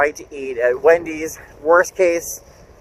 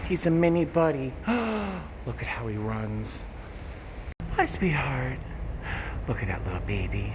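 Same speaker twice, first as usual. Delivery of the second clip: cooing to a cat; 5 semitones higher, 7 dB quieter than usual. Real, not acted. Spotting a stray cat, soft high cooing. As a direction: coax a shy stray without scaring it.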